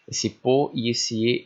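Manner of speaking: "esse po e esse e" spoken slowly, each syllable set apart; slow syllable split. Shown as a demonstration. Teacher spelling out syllables slowly for the class. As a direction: sound out "po" and "e" one by one, slowly. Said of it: "eles acabam se juntando nas sílabas".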